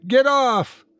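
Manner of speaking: high, forceful angry cry. A narrator's reading. Woman striking the man who grabbed her, high angry cry. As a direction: yelp it high while fighting free.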